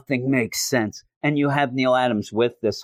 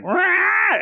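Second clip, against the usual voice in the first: sudden high yelp of a roar; a hammed-up roar while being dragged around; mock roar; 3 dB louder than usual. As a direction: let out a quick strangled rah. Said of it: "Kirk's just like".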